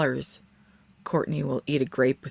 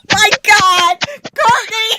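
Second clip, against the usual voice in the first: loud shocked laughing yell; laughing shout; 14 dB louder, slower than usual. Real, not acted. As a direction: shout her name through laughter.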